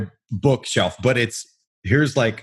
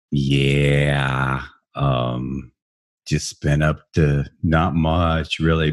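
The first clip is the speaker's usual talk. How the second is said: low, slow, unenthused mumbling tone; off-air voice; 7 semitones lower, much slower than usual. Retold or imitated. Host mimics his chat before recording, low and sluggish. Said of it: "prior to this it was like".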